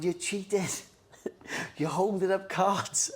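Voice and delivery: put-on high, whining child voice; mock-sobbing complaint; whiny crying kid. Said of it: "fucking crying, going".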